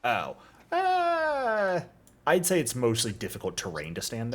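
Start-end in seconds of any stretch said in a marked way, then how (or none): 0.7-1.8 long, high-pitched held "uh"; drawn-out squeaky thinking noise; high hesitant uhhh. Real, not acted. Game master weighing where a player can stand, high hesitant uhhh.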